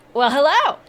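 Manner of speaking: high, loud, eager gnome greeting; excited gnome. Acted. Gnome greeting the adventurers, high and thrilled. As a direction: burst in thrilled to see visitors.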